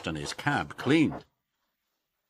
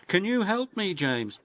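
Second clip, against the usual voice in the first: pleading Thomas; 10 semitones higher than usual. Acted. Thomas asking James.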